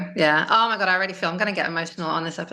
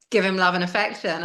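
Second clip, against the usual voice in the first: ordinary talk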